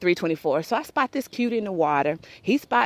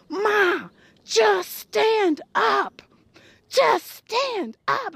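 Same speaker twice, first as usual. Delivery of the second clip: mock yell; 9 semitones higher, much slower than usual. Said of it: "So I finally just start yelling".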